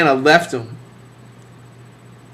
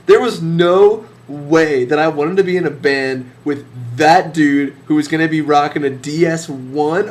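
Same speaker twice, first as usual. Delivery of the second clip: usual speaking voice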